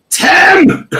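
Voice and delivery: very high, loud call of a name; mock yell, pitched right up; imitated shout. Host reads out Carrie shouting for Tim, high mock yell. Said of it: "Carrie shouting".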